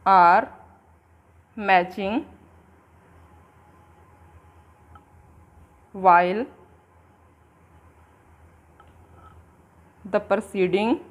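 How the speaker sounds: slow dictation with long pauses between words. Shown as a demonstration. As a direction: give each word alone so the class can write it down. Dictates definition word by word. (धीरे-धीरे शब्द-शब्द लिखवाना)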